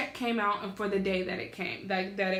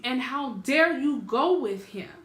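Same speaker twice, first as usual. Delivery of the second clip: louder, higher indignant reproach; heated and emphatic, voice raised; pissed off; 4 semitones higher, 5 dB louder than usual. Retold or imitated. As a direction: reenact an outraged dressing-down of grandma. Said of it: "pissed off".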